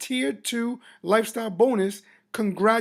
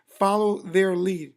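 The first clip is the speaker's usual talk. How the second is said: usual speaking voice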